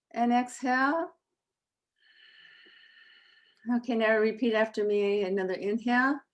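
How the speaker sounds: calm, unhurried breathing guide with long pauses; guided breathing. Acted. Leads breathing before recitation.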